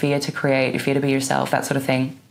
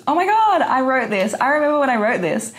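much higher and louder, thrilled; excited surprise; 11 semitones higher, 3 dB louder than usual. Real, not acted. Finding her old journal entry, excited high voice. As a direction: squeal with delight at the memory.

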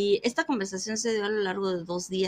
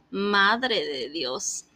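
very slow, drawn-out meme line; TikTok meme voice; much slower than usual. Retold or imitated. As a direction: stretch it like the viral meme. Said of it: "cómo dice el meme de TikTok".

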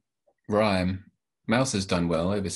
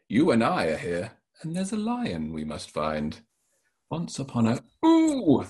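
higher, lively storybook voice to the audience; playful read-aloud; 11 semitones higher, 4 dB louder than usual. Acted. Author reading his book aloud, high playful storytelling. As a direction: read it like to children on the carpet.

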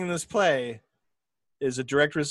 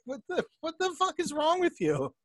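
high, exasperated disbelief; incredulous Speed; 13 semitones higher, 4 dB quieter than usual. Retold or imitated. Speed snapping at Lance, high disbelief. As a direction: throw it up in disbelief. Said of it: "he's like".

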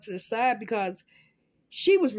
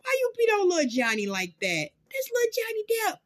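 mock indignant whine; 7 semitones higher than usual. Scolding Amber for Johnny.

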